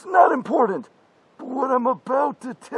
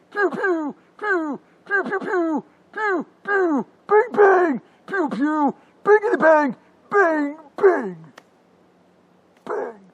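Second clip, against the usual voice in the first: higher playful pew pew sounds; gunshot noises; 6 semitones higher than usual. Acted. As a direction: mimic a pistol firing with your mouth. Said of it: "Like this".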